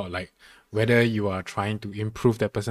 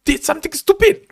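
exasperated retort; 5 dB louder than usual. Retold or imitated. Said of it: "I'm like".

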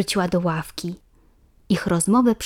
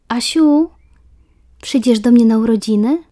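eager invitation; 4 semitones higher, 8 dB louder than usual. A narrator's reading. Ola invites Asia.

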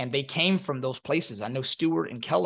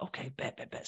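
a much softer, muttered okay bet; muttered agreement; 14 dB quieter than usual. Retold or imitated. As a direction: mumble it to yourself, half agreeing. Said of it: "I'm like".

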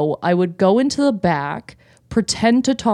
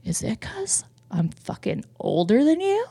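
put-on half-whispered, pausing drunk-customer challenge; quiet, theatrical offended question; hushed mock accusation; 3 semitones higher, 7 dB quieter than usual. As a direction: hiss it softly, as if deeply wounded.

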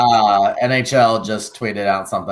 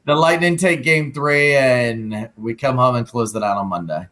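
hyped hockey announcer call; NHL announcer voice; 5 semitones higher than usual. Acted. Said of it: "your best N H L voice".

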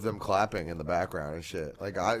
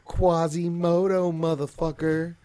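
put-on voice; 8 semitones higher, 4 dB louder, slower than usual. Put-on slow voice.

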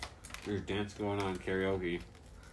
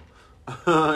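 loud burst of laughter; 9 semitones higher, 13 dB louder than usual. Real, not acted. Laughing at own story.

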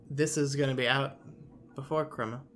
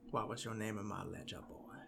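Red Dead outlaw; 5 semitones lower, 13 dB quieter than usual. Retold or imitated. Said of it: "Red Dead".

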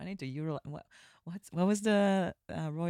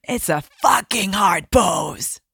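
emphatic; 5 semitones higher, slower than usual. Real, not acted. Emphatic exclamation.

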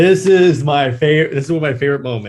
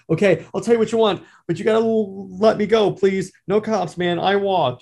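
higher, fast pleading thug; mutant thug voice; 8 semitones higher than usual. Retold or imitated. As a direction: beg fast and high like a cornered punk. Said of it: "The mutant goes".

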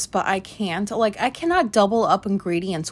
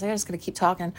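usual speaking voice

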